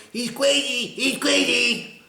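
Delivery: high, loud, slow sing-song taunt; mocking taunt. Mocking people dismissing him as crazy, high loud taunt. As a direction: jeer like a mocking crowd. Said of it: "what they do is go".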